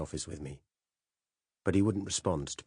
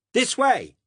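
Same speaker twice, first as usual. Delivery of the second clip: loud high call across the forest; shout; 10 dB louder than usual. Acted. Narrator yelling directions to the police, loud shout. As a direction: yell to steer the cops. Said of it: "I shouted".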